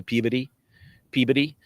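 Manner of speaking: "Peabody" as "PEE-buh-dee", middle reduced; local pronunciation. Shown as a demonstration. Favorite local town name shown, "Peabody" as PEE-buh-dee. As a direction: squash "Peabody" into PEE-biddy. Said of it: "One of my favorites is P E A B O D Y".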